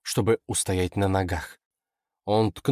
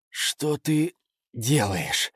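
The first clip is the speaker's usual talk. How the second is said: slow, higher, mildly strained question; squeezed out; 5 semitones higher, much slower than usual. A narrator's reading. Startled victim to his attacker, lightly choked out. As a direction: force the words out slowly. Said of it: "выдавил он".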